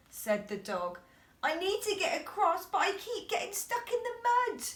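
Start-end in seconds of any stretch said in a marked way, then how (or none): 1.4-4.6 high whiny complaint; stuck dog whining. A narrator's reading. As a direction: whimper it in a high voice. Howling dog speaks.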